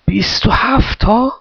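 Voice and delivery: slow, high astonished question; high, stretched-out disbelief, rising; wide-eyed amazement. A narrator's reading. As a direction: draw it out in round-mouthed wonder. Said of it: "یورگن دهانش گرد شد".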